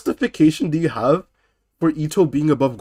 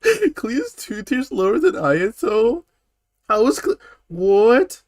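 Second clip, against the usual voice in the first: incredulous; 8 semitones higher, slower than usual. Real, not acted. Outraged at tier placement.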